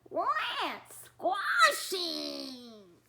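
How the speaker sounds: slow, loud monster shout; little monster growl. Acted. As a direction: drag it out like a gleeful little brute. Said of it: "said the little monsters".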